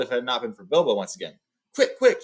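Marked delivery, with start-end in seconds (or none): 1.7-2.2 high, loud panicked urging; gasping warning to the dwarves; urgent gasp. Acted. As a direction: hurry them, breathless with alarm. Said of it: "he gasped".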